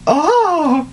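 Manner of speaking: high-pitched helpless laughter; can't stop laughing; laughing hard. Real, not acted. Burst of laughter at text.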